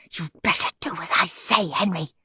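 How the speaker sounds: whispered hiss. A narrator's reading. Margaret hisses a threat at Henry in a whisper. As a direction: hiss a threat under the breath. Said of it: "hissed Margaret".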